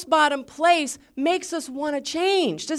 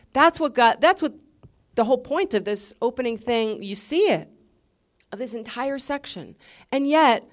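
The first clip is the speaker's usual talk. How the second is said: usual speaking voice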